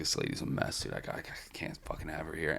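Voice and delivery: near-whispered imitation of the bartender; hushed confiding. Bartender telling customers about the lady, hushed confiding. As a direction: confide it very softly, almost under the breath.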